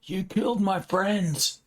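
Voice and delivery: high, drawn-out accusing cry; acted shouting. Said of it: "Ronan starts shouting".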